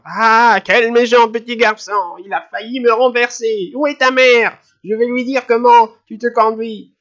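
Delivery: put-on high, loud indignant scolding of a boy; outraged old lady. A narrator's reading. Old lady scolding the boy who nearly knocked her over, high and loud. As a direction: shriek your outrage at the brat. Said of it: "avec un cri de peur".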